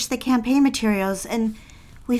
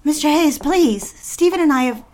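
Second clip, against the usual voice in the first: higher, louder desperate pleading; a secretary pleading with her boss, higher and louder; urgent plea; 5 semitones higher, 5 dB louder than usual. Acted. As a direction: beg him not to cancel her night.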